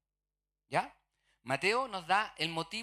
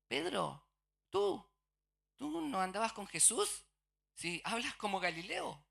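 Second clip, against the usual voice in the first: accusing bystander; 6 semitones higher than usual. Retold or imitated. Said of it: "qué es lo que dicen".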